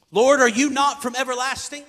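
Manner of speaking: loud, ringing scripture cry; preaching at full force. Acted. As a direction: proclaim it to the back row. Reads Habakkuk's cry at full force.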